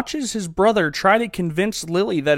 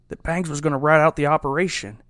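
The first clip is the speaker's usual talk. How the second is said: ordinary delivery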